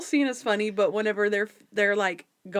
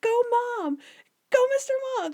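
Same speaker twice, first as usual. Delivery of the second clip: high excited cheer, imitating a boy; cheering kid; faster than usual. Retelling Benji rooting for Monk, high cheering kid voice.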